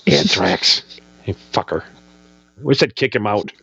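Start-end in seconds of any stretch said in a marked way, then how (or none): 0.1-1.8 mock grumble. Guest cursing his nemesis drummer, low mock grumble. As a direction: grumble it like a Seinfeld nemesis curse.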